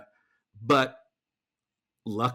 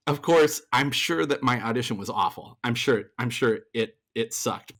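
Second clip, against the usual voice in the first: ordinary delivery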